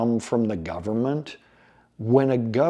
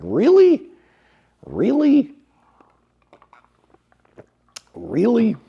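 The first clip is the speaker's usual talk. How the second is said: exasperated disbelief; 12 semitones higher, 6 dB louder, slower than usual. Retold or imitated. Exasperated at government data leaks.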